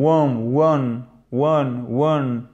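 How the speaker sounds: one with vowel between French A and E; vowel demonstration. Shown as a demonstration. Teacher shows what the symbol gives, slow model of one. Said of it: "le son se prononce entre le A et le E".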